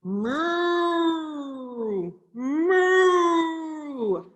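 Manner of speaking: long drawn-out cow moo. A narrator's reading. Reader voicing the cow for a child, long moos. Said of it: "said the cow".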